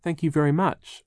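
lightly acted polite child's thanks. A narrator's reading. Klaus thanks Charles for the jerky, polite. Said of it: "Klaus said politely".

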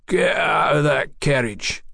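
slow, drawn-out gruff order; a ruffian leader ordering them out, slowly; bandit's drawn-out command. A narrator's reading. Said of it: "he commanded".

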